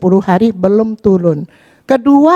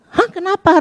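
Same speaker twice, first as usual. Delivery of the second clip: retold high-pitched startled question; startled awake; 9 semitones higher than usual. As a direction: act out jolting awake, pitch jumping up. Waking startled at friend's yell.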